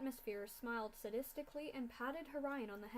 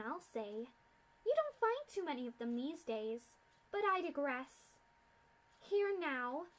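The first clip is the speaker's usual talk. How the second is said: loud, higher, contemptuous mad scientist; haughty mad scientist mocking captives; sneering villain; 4 semitones higher, 5 dB louder than usual. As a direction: look down on these fools. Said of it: "Doctor Atmosphere lectures captives".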